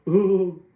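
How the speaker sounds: a short loud laugh; loud burst of laughter. Real, not acted. Vlogger laughing at his own [name] impression, loud laugh.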